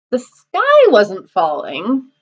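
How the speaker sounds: SKY stretched out slowly and emphasised; stress on SKY. A narrator's reading. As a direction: lean long on SKY to set up the twist. Punchline correcting what fell.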